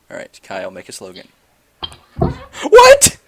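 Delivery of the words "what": shocked squeal. Real, not acted. Reacting to silly slogan.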